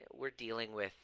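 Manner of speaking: ordinary talk, nothing marked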